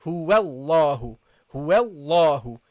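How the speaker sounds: Huwallahu drawn low and smooth, waw gliding into the lam; connected recitation. Shown as a demonstration. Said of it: "go from the waw to the lam".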